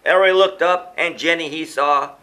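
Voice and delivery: ordinary delivery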